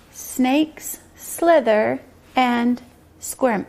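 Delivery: very slow, one word at a time; slow message reading. Shown as a demonstration. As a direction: say each word separately, with clear pauses. Pointing out words while reading.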